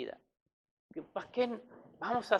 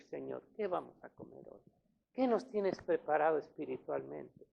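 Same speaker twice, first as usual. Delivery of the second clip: soft, lower, hushed little prayer; quiet mock prayer; 4 semitones lower, 9 dB quieter than usual. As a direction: murmur it like a humble plea to the Lord. Oración imitada de los fieles. (oración suave y baja, imitada)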